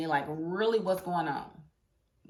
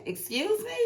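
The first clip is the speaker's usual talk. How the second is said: much higher, offended excuse me; indignant; 13 semitones higher, 3 dB quieter than usual. Retold or imitated. Fan snaps back at the boss on the heroine's behalf. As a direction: rise high in offended disbelief.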